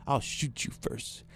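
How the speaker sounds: hushed mock menace; imitating a quiet threat. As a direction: deliver the threat slowly, almost under the breath. Girlfriend's threat after slamming coffee.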